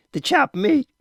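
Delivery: high astonished repeat; surprised. A narrator's reading. Father realizes what son took, surprised.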